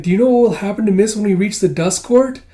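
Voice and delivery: high, loud, worried character voice; anxious questioning. Acted. Kippa asks the doctor about her friend, anxious.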